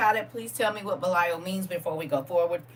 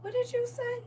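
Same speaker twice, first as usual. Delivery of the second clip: soft, high-pitched bewildered question; mock baffled; 10 dB quieter than usual. Listener puzzled by country talk, mock baffled. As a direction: squeak it softly, as if you can't understand. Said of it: "You be like".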